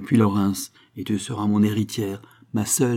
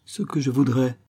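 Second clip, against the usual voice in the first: higher, tremulous exclamation; acted emotional outburst, pitched up; trembling voice; 4 semitones higher than usual. A narrator's reading. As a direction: begin pleading with a slight tremble. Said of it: "s'écria-t-elle d'une voix tremblante".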